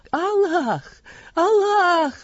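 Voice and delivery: high, loud panicked cry to Allah; terrified cry. A narrator's reading. Trembling merchant caught in a false oath, crying out high and loud in fear. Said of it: "воскликнул тот".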